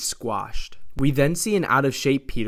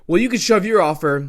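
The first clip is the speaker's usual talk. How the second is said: higher, louder, defiant; angry yell; 7 semitones higher, 6 dB louder than usual. A narrator's reading. Peter rejects the demon's offer, defiant yell. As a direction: yell the refusal in a raised pitch. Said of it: "Peter yells at him saying".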